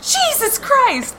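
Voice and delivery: very high, drawn-out startled cry; slow, high-pitched mock scream; retold scream. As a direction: re-enact a shriek of shock, volume held back. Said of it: "I screamed and said".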